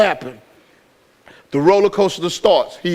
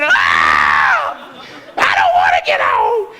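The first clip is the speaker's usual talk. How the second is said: mock screaming; slower than usual. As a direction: scream it out in hammed-up panic. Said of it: "He started screaming".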